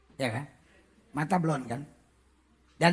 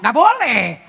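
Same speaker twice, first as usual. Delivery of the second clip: ordinary talk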